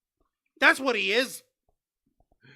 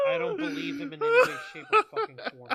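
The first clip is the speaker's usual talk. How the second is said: laughing through words; 9 semitones higher, faster than usual. Real, not acted. Both laughing at the promo.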